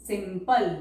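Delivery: ordinary delivery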